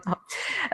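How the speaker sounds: short, high embarrassed giggle; embarrassed laugh. Real, not acted. Lecturer apologising for butchering German names, embarrassed laugh. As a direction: laugh it off sheepishly.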